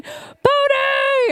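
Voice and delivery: put-on very high shouted call after a runaway child; screaming a name. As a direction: yell his name across the playground. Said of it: "we're screaming".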